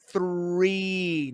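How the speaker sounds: "Three" said slow and drawn out; stress on three. Real, not acted. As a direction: lean into "Three" to correct. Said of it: "not six".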